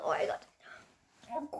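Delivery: slow whispered despair; slow voiceless moan; breathy whisper. Real, not acted. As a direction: whisper it in defeat. Despair at coconut taste.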